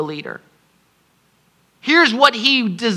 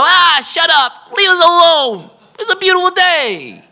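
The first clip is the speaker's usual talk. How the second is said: dismissive crowd; 8 semitones higher, 4 dB louder than usual. Retold or imitated. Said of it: "Now people go".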